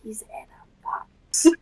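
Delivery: slow, hushed whisper. Acted. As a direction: lean in and whisper a secret. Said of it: "lean over and whisper".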